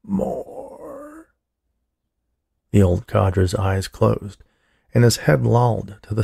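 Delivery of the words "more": high, faint breath of a word; whisper. A narrator's reading. Dying old man whispering to the orderly for more. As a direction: fade out on the word like a last breath. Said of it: "Then, in a whisper".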